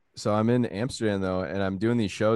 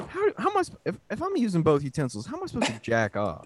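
mock indignant diner; 8 semitones higher than usual. Asking waiter in bit.

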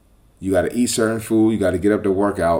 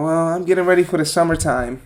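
mocking the client; 8 semitones higher than usual. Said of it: "She's talking about".

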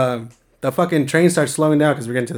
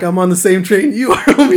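laughing retort; 5 semitones higher, 7 dB louder than usual. Retold or imitated. Narrator laughing while quoting himself to the train dude. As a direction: laugh through the line, voice jumping up high and loud.

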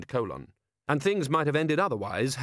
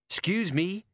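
ordinary delivery, nothing marked

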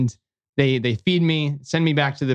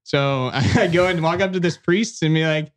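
ordinary talk, nothing marked

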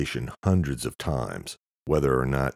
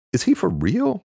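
much higher, louder disbelief; incredulous; 6 dB louder than usual. Acted. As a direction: scoff in disbelief. Luke incredulous at Quan.

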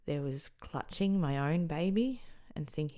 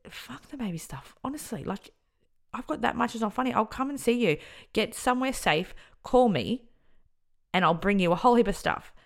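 higher and louder, rushed urgency; urgent pleading; 7 semitones higher, 9 dB louder than usual. Retold or imitated. To a frightened friend on the phone, urgent pleading. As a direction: beg her to just get out now.

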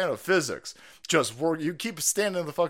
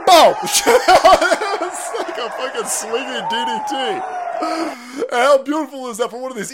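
laughing shout; 8 semitones higher, 9 dB louder than usual. Real, not acted. Laughing at the clip.

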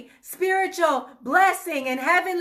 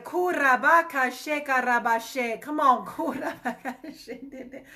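speaking in tongues; 3 semitones lower than usual. Real, not acted.

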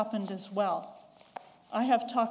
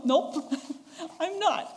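laughing; 6 semitones higher, slower than usual. Real, not acted. Laughing at own reply.

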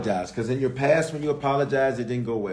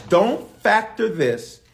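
slow, every word pressed; slow emphatic command; 5 semitones higher, slower than usual. Acted. Preacher voicing God to the congregation, slow emphatic. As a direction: drag out the warning.

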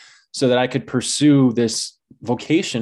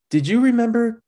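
mom voice; 9 semitones higher than usual. Retold or imitated.

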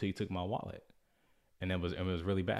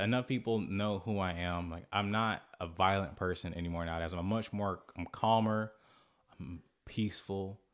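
ordinary delivery

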